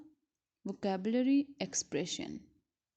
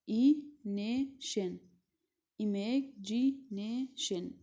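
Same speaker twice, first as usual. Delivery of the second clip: imagination drawn out slowly; teacher modelling the syllables for the class; slow syllable demo; slower than usual. Shown as a demonstration. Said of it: "in imagination we have fifth, five syllables".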